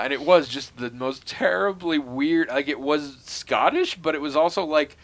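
ordinary talk, nothing marked

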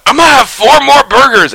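high, loud excited outburst; Biden. Acted. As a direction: burst in hollering like an overexcited kid. Biden loudly interrupts a polite greeting.

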